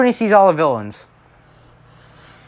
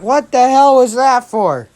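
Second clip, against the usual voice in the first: high, loud, drawn-out protest; indignant mock yell; 8 semitones higher, 5 dB louder, slower than usual. Deku just smacked by the returning hero, indignant mock yell. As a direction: yelp it in hammed-up outrage.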